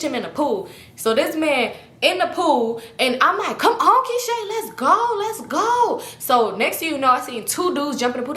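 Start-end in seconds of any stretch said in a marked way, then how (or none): 3.6-6.0 higher, hurried urging; mock urgent. Urging Keisha to leave the pool party, anxious. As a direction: hustle her out the door.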